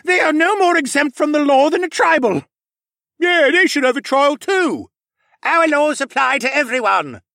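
loud, high shouts of protest; heated yelling, pitched high; crowd outcry. A narrator's reading. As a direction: call out indignantly from an angry mob.

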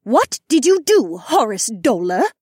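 high, loud, slowing accusation; accusing growl. A narrator's reading. Phoenix woman rounding on the falcon man, loud high growl. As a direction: demand it sharply, drawing out the name. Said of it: "the Phoenix woman growled".

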